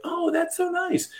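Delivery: high, soft, sugary mock praise; high airy mock-sweet voice; mock gushing. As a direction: parody a gushing commenter. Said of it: "people who are like".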